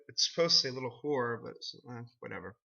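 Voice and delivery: low, soft and fast under the breath; low dismissive mutter; muttered aside. Real, not acted. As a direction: toss off the correction quietly.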